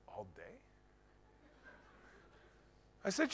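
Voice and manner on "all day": stunned question. Retold or imitated. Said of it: "She said".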